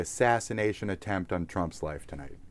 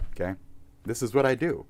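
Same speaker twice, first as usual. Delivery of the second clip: ordinary talk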